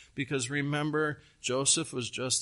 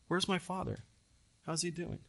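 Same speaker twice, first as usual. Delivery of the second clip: acted soft question; 2 semitones higher, 7 dB quieter, faster than usual. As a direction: drop to a softer voice, imitating Joseph. Acts out Joseph asking brothers.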